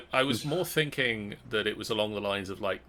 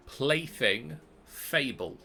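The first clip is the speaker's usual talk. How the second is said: slow repeat; slower than usual. Shown as a demonstration. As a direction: repeat it slowly for clarity. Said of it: "Sorry, I missed that first word".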